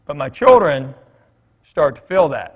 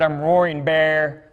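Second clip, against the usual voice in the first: put-on slow, puffed-up kid announcing a name; proud kid voice; slower than usual. Schoolboy to his teacher, slow and proud. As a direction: growl out the name like a proud little boy.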